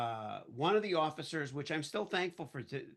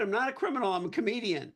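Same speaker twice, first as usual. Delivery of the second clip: high, raised, insistent plea; pleading protest; 7 semitones higher, 5 dB louder, faster than usual. Retold or imitated. Arrested comedian pleading with police, loud protest. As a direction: protest your innocence urgently.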